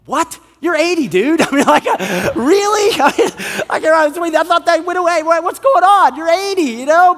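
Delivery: high-pitched incredulous outburst, breaking into laughs; acted disbelief. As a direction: react aghast, half laughing. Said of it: "I'm like, "What?".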